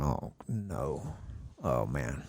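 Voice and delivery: very low, hushed "uh-oh"; quiet, sinking inner groan; retold dread. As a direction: sink low and soft, as if thinking in dread. Dreading the pastor question.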